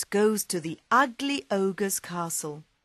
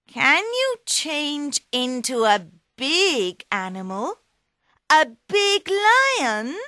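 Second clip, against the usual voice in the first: higher cat voice, rising to a high question; curious cat; 8 semitones higher, 5 dB louder than usual. Acted. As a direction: tease the ogre, climbing up high. Puss tempts the ogre.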